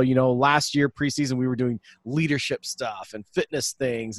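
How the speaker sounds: bright podcast host intro; hyped show intro. Acted. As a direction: sell the episode like a radio host. Hyped podcast intro over music.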